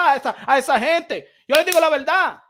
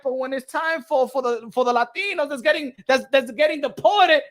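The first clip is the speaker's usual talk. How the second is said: normal talking voice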